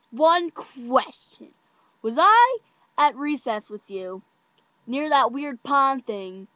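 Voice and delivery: slow, loud, emphatic interrogation; deliberate questioning. Retold or imitated. Checking it is really him, slow questioning. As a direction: stretch out each phrase to test him. Testing his identity.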